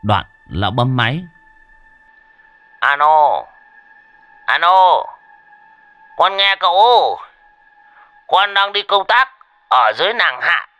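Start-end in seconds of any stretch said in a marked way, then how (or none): 2.8-10.7 put-on high, loud phone call as the old man; old man calling into a phone, higher and louder. A narrator's reading. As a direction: shout down the line to his boss.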